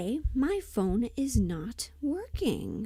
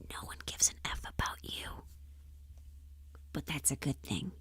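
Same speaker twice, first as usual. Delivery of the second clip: confiding whisper; 5 semitones lower, 8 dB quieter than usual. Real, not acted. Blunt advice dropped to a hush.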